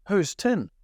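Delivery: high, leading mock query; pointed question. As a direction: imitate a stepdad challenging the answer. Stepdad's pointed questioning, acted out.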